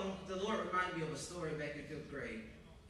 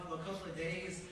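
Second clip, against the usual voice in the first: ordinary talk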